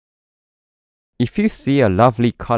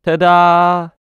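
a big high ta-da, louder; excited reveal; 8 semitones higher, 5 dB louder than usual. Acted. Narrator revealing the children's finished rainbow painting, excited and loud. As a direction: show off the picture with a flourish.

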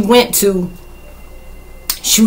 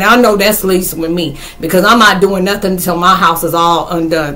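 ordinary delivery

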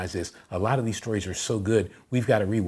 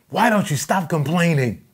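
high-pitched, exasperated dad yell; 8 semitones higher, 5 dB louder than usual. Retold or imitated. Dad scolding his complaining son, yelling. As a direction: mimic a fed-up father raising his voice. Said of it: "the dad's yelling".

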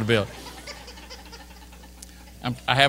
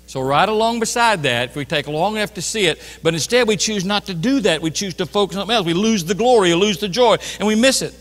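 loud, high, driving sermon delivery; full-voiced preaching; 7 semitones higher, 8 dB louder than usual. Preacher applies lesson and reads Exodus to congregation, full-voiced preaching. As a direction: press on urgently, filling the room.

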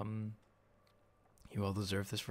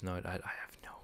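whispering; 4 semitones lower, 8 dB quieter than usual. Real, not acted. Muttering to herself at puzzle.

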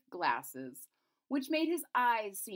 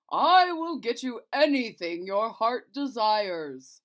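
loud, slow royal promise; a king vowing to his sick daughter, loud and slow; king pledging anything to his daughter, loud and slow; booming king; 3 semitones higher, 10 dB louder, slower than usual. Acted. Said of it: "the king said".

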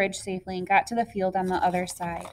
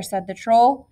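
usual speaking voice

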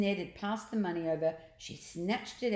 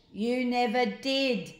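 retort; 5 semitones higher, 5 dB louder, slower than usual. Acted. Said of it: "retorted the boy".

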